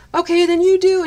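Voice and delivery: high, loud kid imitation; demanding kid. Kid pressing the painting teacher for another accent, high and loud. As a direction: pipe up insistently like a child. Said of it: "there was this one kid".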